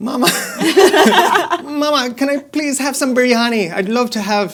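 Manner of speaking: high, loud put-on Irish accent, laughing; mock Irish kid. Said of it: "kids come out of school with an Irish accent".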